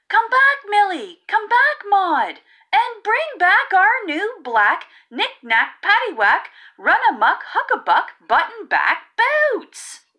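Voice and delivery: high, loud calling out, slowing at the end; kids crying out. Acted. Kids calling from the mountain for the cows, high loud cry. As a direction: call after the cows as the kids. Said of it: "cry the Huckabuck kids".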